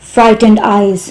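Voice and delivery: plain reading voice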